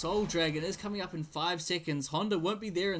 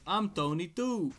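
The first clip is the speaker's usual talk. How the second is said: put-on Italian accent; 3 semitones higher than usual. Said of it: "Sorry for my terrible Italian accent".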